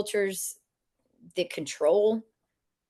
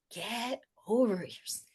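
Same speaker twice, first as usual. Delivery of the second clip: quoting the dog; 3 semitones higher, 7 dB quieter than usual. Retold or imitated. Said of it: "and I quote".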